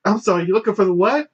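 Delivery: put-on high, quick questioning girl voice; puzzled girl clerk. Clerk asks mumbling shopper to repeat, puzzled high voice. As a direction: imitate a clerk who didn't catch it, pitched high. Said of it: "And she's just like".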